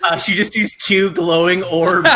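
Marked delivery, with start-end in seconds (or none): none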